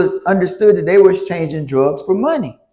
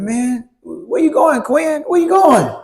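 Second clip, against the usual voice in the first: calling after; 8 semitones higher, slower than usual. Retold or imitated. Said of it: "He said".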